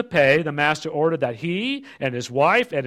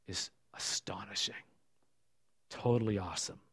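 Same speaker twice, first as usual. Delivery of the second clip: awed whisper; 6 semitones lower, 12 dB quieter than usual. Real, not acted. Hushed awe over forgetting sin.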